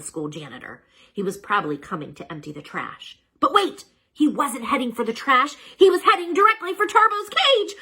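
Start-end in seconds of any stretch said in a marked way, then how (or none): 3.4-7.7 higher and louder urgent narration; dramatic alarm. A narrator's reading. Narrator builds alarm as janitor heads for the cage. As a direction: build the suspense.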